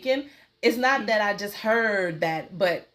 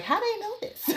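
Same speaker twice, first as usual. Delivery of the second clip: high puzzled voice; 11 semitones higher, 4 dB quieter than usual. Retold or imitated. Client's puzzled question acted out.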